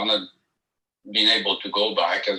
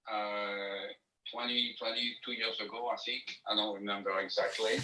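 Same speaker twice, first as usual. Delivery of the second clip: phone held away; 13 dB quieter than usual. Real, not acted. Caller on speakerphone, far from mic.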